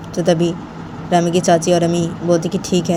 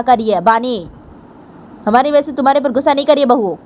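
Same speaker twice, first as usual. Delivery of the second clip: put-on higher, stern stepmother voice scolding the girl; a higher, scolding older woman; 7 semitones higher than usual. Stepmother hushes Bani.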